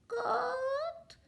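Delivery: tiny squeaky chick cluck; a freshly hatched chick peeping for mama; very high baby cheep. Acted. Newly hatched chick cries to mother.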